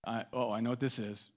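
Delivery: low, soft dread; sinking realization under his breath; quietly bracing for trouble; uneasy foreboding. Acted. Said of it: "And then I go".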